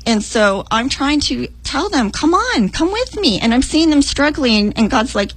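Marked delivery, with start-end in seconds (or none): 2.1-3.4 urging. Retold or imitated. Urging strugglers to follow her.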